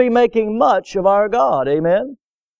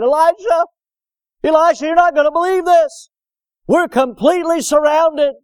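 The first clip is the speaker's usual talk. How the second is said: much higher, louder alarmed cry; panicked servant; 8 semitones higher, 3 dB louder than usual. Acted. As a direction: burst in terrified to wake the prophet. Panicked servant runs to Elisha.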